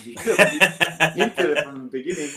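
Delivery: half laughing it out, as if back at the job offer; laughing while quoting himself. Retold or imitated. Salesman telling the host what he told his bosses, laughing.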